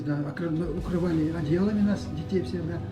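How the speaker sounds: ordinary talk, nothing marked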